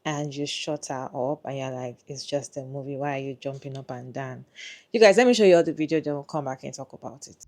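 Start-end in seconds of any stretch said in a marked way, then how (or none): 2.1-4.4 soft, understated brush-off; mildly dismissive. Acted. Partner shutting up his excited date, mildly dismissive. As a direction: quietly shut down the excitement.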